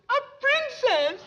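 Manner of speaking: high excited exclamation. Acted. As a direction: announce it with a thrill. Announces princess to husband.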